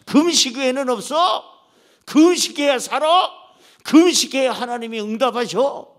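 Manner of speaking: 크게 높여 몰아붙이듯; 금식하라고 호통치듯 크게; 다그치는 외침. Retold or imitated. 목사가 금식하라고 다그침. (목사님들 앉혀놓고 다그치는 큰 외침)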